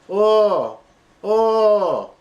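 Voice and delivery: very high, loud moans; ecstatic cries. Acted. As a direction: cry out at the peak. Climax cries continue.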